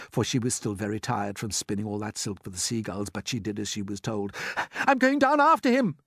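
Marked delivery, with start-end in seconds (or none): 4.9-6.0 high, loud urgent announcement; boy crying out in a hurry; determined cry. A narrator's reading. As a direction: call out a brave decision. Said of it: "cried James".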